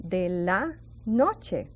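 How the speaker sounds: de la noche said slowly, each word set apart; teacher modelling slowly for learners; slow model phrase. Shown as a demonstration. Teacher teaching evening time, slow and clear. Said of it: "we say".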